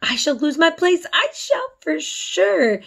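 high, fearful wail, slowing at the end; panicked. A narrator's reading. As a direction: dread of losing her job. Martha fearing for her job.

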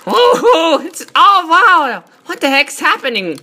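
high, excited exclamations; gasp-and-holler at a wild spectacle; real gamer astonishment, voice jumping up; amazed. Real, not acted. Amazed at game cutscene.

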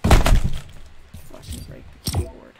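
slow, breathy whisper through frustration; seething whisper. Real, not acted. Streamer muttering about smashing his keyboard, slow whisper. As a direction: hold the anger in and let it out in a whisper. Gamer seething after loss.

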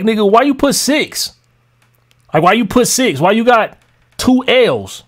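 ordinary talk

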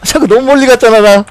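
high, loud frantic pleading; panicking in a close fight; excited. Real, not acted. Tense fight, begging teammate.

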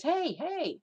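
high sharp calling out hey hey. Retold or imitated. Paul tied to post calls out to whipper, high and sharp. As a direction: get the whipper's attention. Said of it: "And he says".

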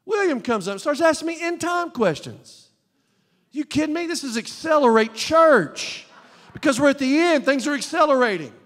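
high-pitched, emphatic pulpit delivery; forceful preaching. Real, not acted. Preacher telling his church about end time questions, forceful preaching. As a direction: preach it with rising urgency.

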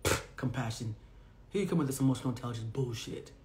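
a laughing friend's contemptuous sneer; mocking dismissal. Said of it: "two people laughed in my face".

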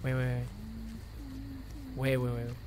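very low, quiet murmur; low, muted talking to yourself; hushed stalking. Real, not acted. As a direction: keep your voice right down while listening for prey. Stalking an opponent in the game.

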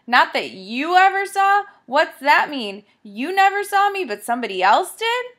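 loud, high, challenging demand; angry confrontation. Acted. Jack in Dusty's face over his answer, loud angry demand. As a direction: get right in his face and push back hard.

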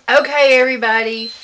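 ordinary talk, nothing marked

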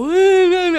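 high, loud imitated whine; mock child whining. Kids coming up to mom with problems, exaggerated whining. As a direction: wail like a complaining kid. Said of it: "and start whining".

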